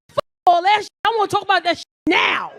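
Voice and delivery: high, very loud angry shout; furious comeback. Acted. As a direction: explode at his calm suggestion. Acted quarrel with calm partner.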